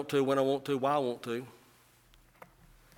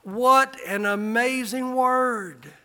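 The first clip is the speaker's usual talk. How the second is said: loud, high, fervent pulpit preaching; a revival preacher at full cry; preaching at full force; 8 semitones higher, 13 dB louder than usual. Acted. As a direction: preach it out and lift them up. Pastor preaching to congregation.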